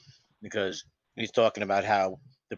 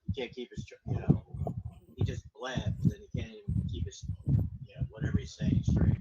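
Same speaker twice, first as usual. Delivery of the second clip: far from mic. Real, not acted. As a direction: voice thin and distant from the mic. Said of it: "there's something going on with your mic".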